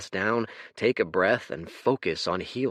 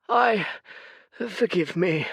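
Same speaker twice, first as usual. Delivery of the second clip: put-on high, tired old man voice; a sorrowful old oracle; weary old seer; 11 semitones higher than usual. Fate's weary voice.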